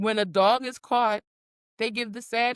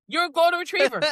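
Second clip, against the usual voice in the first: excited verdict; 8 semitones higher, 4 dB louder, faster than usual. Real, not acted.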